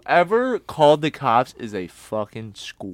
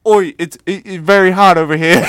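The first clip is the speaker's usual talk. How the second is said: higher, louder mock Traveller accent; Irish Traveller accent; 4 semitones higher, 7 dB louder than usual. Mimicking someone complaining about summer heat, mock Traveller accent. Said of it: "Say it in a, in a pikey accent".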